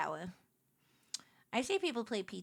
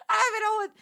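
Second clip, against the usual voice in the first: exasperated outburst; 10 semitones higher, 9 dB louder, much faster than usual. Real, not acted.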